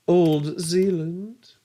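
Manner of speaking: put-on slow, drawn-out "Old Zealand"; slow joking stress. Joking about Larissa's country, slow. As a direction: stretch "Old Zealand" as a joke. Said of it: "joking name repeated".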